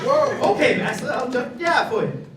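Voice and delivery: high, loud eager compliance; mock eager servant. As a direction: play the scared puppet. Puppet obeying master.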